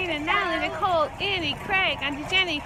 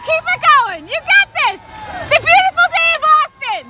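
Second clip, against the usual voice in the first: cheering; 7 semitones higher than usual. Real, not acted. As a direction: cheer at the top of the voice. Cheering runners passing by.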